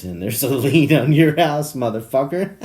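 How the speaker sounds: loud, high, laughter shaking the words; laughing mock gloat. Nephew springing a lien on his uncle, gleeful laughing. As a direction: gloat while cracking up.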